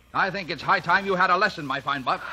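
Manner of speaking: a father laying down the law; angry lecture; stern anger. Acted. Father announces punishment.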